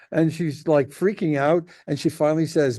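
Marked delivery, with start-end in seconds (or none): none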